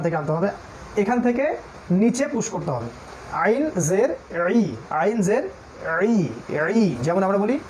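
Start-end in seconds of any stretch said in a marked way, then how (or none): none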